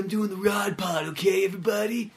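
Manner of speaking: high, loud rocker shouting a podcast intro. Acted. Co-host jokes as a singer launching his podcast, hammed-up rock-star shout. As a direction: belt it out as a hammed-up singer, stretching the words. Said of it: "this is [name]".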